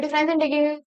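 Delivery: rattled off as a speed demo; too quick to follow easily; very fast. Shown as a demonstration. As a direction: race through it, words running together. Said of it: "ऐसे बोल रही हूँ मैं".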